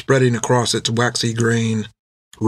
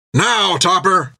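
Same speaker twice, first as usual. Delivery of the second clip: high, slow shouted command; 7 semitones higher, slower than usual. A narrator's reading. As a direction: give the gunner the signal. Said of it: "shouted Jack".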